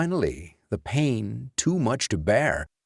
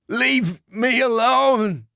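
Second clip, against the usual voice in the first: much higher, louder, slow desperate cry; moaning plea; 13 semitones higher, 5 dB louder, slower than usual. A narrator's reading. Beaten Lance pleads with the dead man, desperate. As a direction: beg the ghost to stop. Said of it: "Lance moaned".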